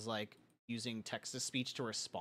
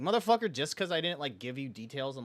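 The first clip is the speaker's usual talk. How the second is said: louder, higher exasperated rant; exasperated outburst; 3 semitones higher, 6 dB louder than usual. Real, not acted. Complaining to friends about the girl, exasperated. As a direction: vent the frustration.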